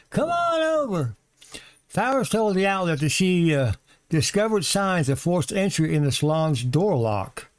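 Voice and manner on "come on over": high, sing-song come-on-in invite; mock welcoming host. Vlogger mocks the agent inviting strangers in, sing-song welcome. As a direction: play the breezy agent waving everyone inside.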